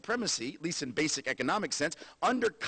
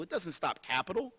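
ordinary talk

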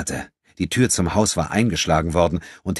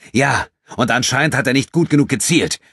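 higher, louder and biting; hissing anger; 7 semitones higher, 6 dB louder than usual. A narrator's reading. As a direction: spit the words back at him. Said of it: "fauchte André".

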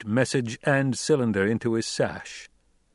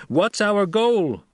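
higher, louder, commanding orator; young duke addressing tribe; 10 semitones higher, 5 dB louder, slower than usual. A narrator's reading. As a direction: challenge the crowd with rising authority. Paul addresses the throng.

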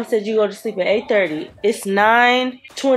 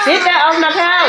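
firm raised scolding voice; 8 semitones higher, 5 dB louder than usual. Real, not acted. Scolding kids off the couch.

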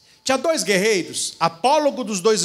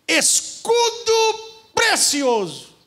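high, slow marvelling cry of a warrior; awed warrior; 12 semitones higher, much slower than usual. Acted. Warrior admiring the shield Minerva holds, high and drawn out. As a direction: gasp it out in wonder at the shield. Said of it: "o cara olhou e disse".

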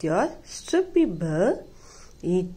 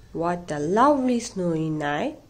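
ordinary delivery

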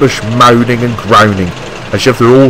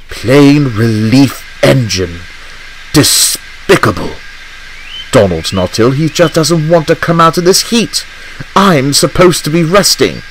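Henry fuming; 4 semitones higher than usual. Acted. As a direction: spit out the complaint with disdain.

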